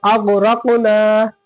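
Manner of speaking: अग़रक़ना chanted slowly with a thick "ro"; heavy ra. Shown as a demonstration. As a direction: make the र in अग़रक़ना full and heavy. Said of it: "मोटा पढ़े हम लोग".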